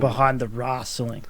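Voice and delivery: low, slow Southern-style "wrasslin'"; lightly put-on Southern drawl. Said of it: "when you said under the, like, wrestling".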